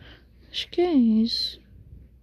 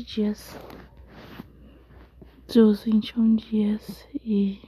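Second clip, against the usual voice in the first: whispering. Real, not acted. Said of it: "eu tô sussurrando porque eu tô com sono".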